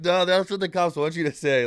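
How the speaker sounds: high, loud paranoid retort; hammed-up stoner suspicion; mock paranoid stoner. Stoned roommates answering the trooper, mock paranoid.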